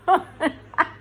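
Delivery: high burst of laughter. Real, not acted. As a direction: laugh at your own joke. Laughs at own bit.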